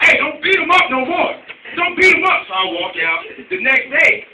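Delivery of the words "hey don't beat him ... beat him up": loud, higher mock yell at nobody. Teacher yelling at the invisible bully, mock yell. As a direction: shout at the empty bathroom. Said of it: "so I'm yelling in the bathroom".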